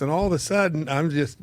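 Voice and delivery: usual speaking voice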